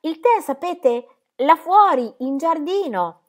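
high, slow and insistent; anxious explaining. A narrator's reading. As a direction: spell it out, pitched well up. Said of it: "seguendole e gesticolando".

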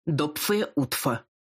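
slow, wet lisping greeting; slushy lisp. A narrator's reading. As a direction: lisp it out slowly and thickly. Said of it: "раздался шепелявый голос".

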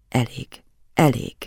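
slightly husky professor's voice; hoarse. A narrator's reading. Professor stopping the child's writing, lightly hoarse. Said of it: "a professzor rekettes hangját hallottam".

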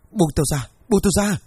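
frightened man yelling let go; panicked shout. A narrator's reading. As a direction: shout to get free in terror. Terrified man pulls free.

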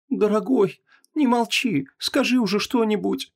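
high, pleading woman's voice; plaintive. A narrator's reading. Wife begging her silent husband to speak, plaintive. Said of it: "жалобно попросила Ванесса".

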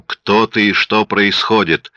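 usual speaking voice

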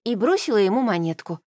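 ordinary delivery, nothing marked

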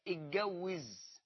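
اتجوز drawn out slowly with a hard g; colloquial Egyptian form. Shown as a demonstration. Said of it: "ما بتقولش تزوج". (نطق عامي مصري بطيء لكلمة اتجوز)

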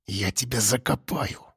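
quiet, slow threat; menacing low threat. Acted. Threat up close.

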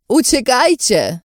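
high, loud, drawn-out shriek; sudden scream. A narrator's reading. Captive screaming at friends to flee, sudden scream. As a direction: scream the warning out. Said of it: "wrzasnęła nagle".

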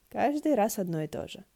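usual speaking voice

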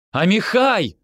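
high, loud cry of a name; desperate call. A narrator's reading. Yoni calls Amihai boarding the bus, shouting. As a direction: shout after him, pitch leaping up. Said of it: "снова закричал я".

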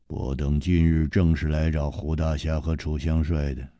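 low, cold and steady; an icy low command; cold villain. Acted. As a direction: lay down the terms coldly. Villain speaks coldly.

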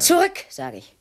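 high, loud command; sharp shouted order. Acted. Woman orders the intruder back, shouting. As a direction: drive him back with a shout.

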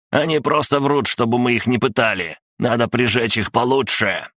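high, agitated protest; indignant shouting. A narrator's reading. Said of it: "закричал Сандер".

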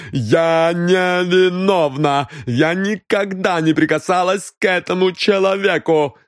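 high, drawn-out wailing protest; frantic denial. A narrator's reading. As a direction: plead innocence in panic. Denies the murder. (отчаянное отрицание вины)

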